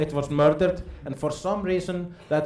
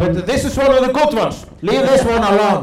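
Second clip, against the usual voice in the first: loud, high order to back off; hammed-up defender's yell; mock protective shout; 6 semitones higher, 10 dB louder than usual. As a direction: shout them off your friend in jest.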